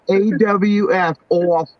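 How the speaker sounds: slow spelling "A W F", then New York "off". Shown as a demonstration. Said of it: "the way New Yorkers said it".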